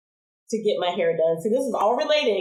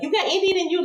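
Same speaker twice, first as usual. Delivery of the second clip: much higher, prying tone; mock nosy question; 10 semitones higher, faster than usual. Nosy people quizzing the girl about her long hair. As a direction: ask like a nosy stranger.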